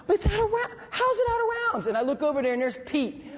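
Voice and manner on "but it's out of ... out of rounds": high, disbelieving cry; disbelief. Retold or imitated. Gunner finding his gun empty mid-firefight, shocked disbelief. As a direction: voice jumps up in shock.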